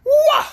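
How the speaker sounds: high, loud chanted spell syllable; a boy's loud spell cry; magic shout. A narrator's reading. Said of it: "and said".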